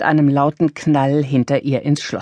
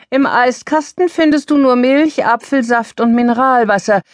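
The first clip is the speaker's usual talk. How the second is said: raised, higher call to someone leaving the room; calling after her; 8 semitones higher, 4 dB louder than usual. A narrator's reading. Monika calls after her mother heading to the kitchen, raised call. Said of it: "rief ihr Monika nach".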